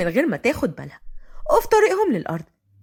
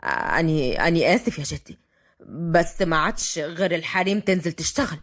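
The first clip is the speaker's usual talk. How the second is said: low-pitched, hesitant male apology; regretful, still sharp; 8 semitones lower than usual. A narrator's reading. Fahd apologises to his grandfather, regretful. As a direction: apologise haltingly in a lowered voice. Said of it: "رد فهد بحدة وندم".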